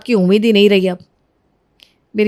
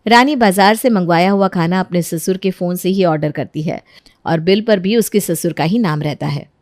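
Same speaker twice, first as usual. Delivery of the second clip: calm, lower storyteller voice explaining the plot; story narration; 6 semitones lower than usual. A narrator's reading. Narrator explains Rani's trick to the audience, in a lower, even storytelling voice. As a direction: narrate it evenly, like telling a tale.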